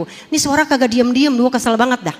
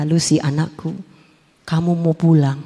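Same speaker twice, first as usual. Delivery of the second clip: divine voice; 10 semitones lower than usual. Retold or imitated. A lightly voiced God calling Lucy home. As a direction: speak gently from above as God. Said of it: "Ada sebuah suara berkata".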